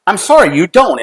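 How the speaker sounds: high, loud, firm insistence; retold argument, emphatic contradiction; arguing. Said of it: "we had this huge argument".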